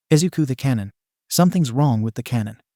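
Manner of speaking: usual speaking voice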